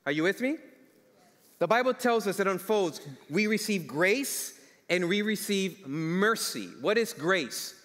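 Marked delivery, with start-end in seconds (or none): 3.3-6.7 slow emphasis. Real, not acted.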